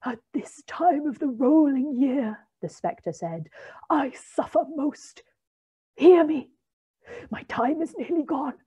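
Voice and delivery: Marley's ghost demanding belief from Scrooge; ghostly voice. A narrator's reading. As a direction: ring it out like a tormented ghost. The ghost speaks.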